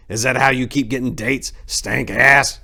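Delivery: put-on high, loud taunting old man; mean old man. Old Apricot taunting Four-way, high loud jeer. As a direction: taunt him with a sneer. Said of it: "asked Apricot".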